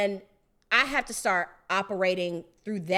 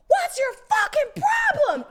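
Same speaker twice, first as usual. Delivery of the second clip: yelling at the sky; 5 dB louder than usual. Retold or imitated. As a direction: shout up at God through the rain. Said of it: "I was outside screaming at the sky".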